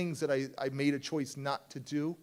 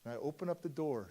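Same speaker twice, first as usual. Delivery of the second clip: ordinary talk, nothing marked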